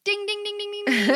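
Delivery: high, ringing ding-ding-ding; rapid-fire mock bell, breaking into laughter; imitated winner's bell. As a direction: play the correct-answer bell with your voice. Imitates bell for a right answer.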